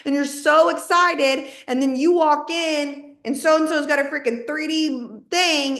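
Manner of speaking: ordinary delivery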